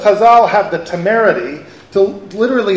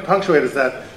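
ordinary talk

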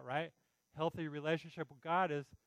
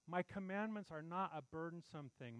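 softer, tender appeal; soft, caring call to listen; gentle voice of Jesus; 9 dB quieter than usual. Acted. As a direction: coax them quietly to listen. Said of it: "Jesus is saying".

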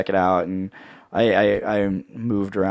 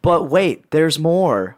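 infomercial voice; 5 semitones higher, slower than usual. Pretend. Mock infomercial pitch.

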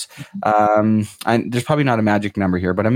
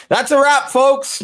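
much higher, hammed-up host voice; mock show sign-off; 14 semitones higher, slower than usual. Podcast host fakes wrapping the episode in a joking high voice. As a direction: pretend to end the show with a cheesy squeak. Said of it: "No, I'm just kidding".

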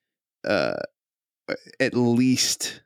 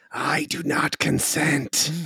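slow, higher dragon voice; 6 semitones higher, slower than usual. Acted. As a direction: speak it slowly as the dragon. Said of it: "says in dragon voice".

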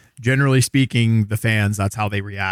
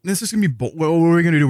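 usual speaking voice

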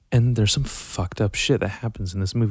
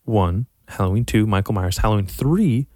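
ordinary talk, nothing marked

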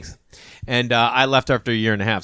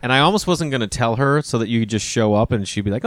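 ordinary talk, nothing marked

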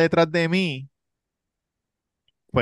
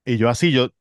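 usual speaking voice

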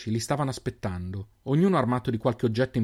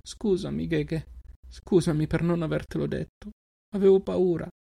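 a little sister apologising through welling tears; tearful apology; 7 semitones higher than usual. A narrator's reading. Little sister confessing her fear to her brother, tearfully. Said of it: "gli occhi di lei si riempirono di lacrime".

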